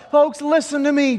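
high, loud call for attention; urgent appeal. Acted. Joshua pleading with the people to listen, urgent. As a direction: call out to win over a crowd. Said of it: "Joshua said".

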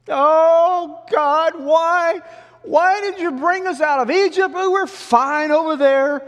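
very high, loud hammed-up lament; mock wailing. Israelites blaming God for leaving Egypt, mock wailing. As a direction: wail it to the heavens. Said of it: "they began to moan and wail".

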